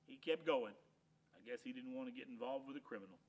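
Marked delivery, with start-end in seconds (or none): none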